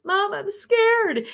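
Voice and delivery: put-on high, slow, small child voice; a child telling mom she is frightened; scared child. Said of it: "your kid comes up to you and says".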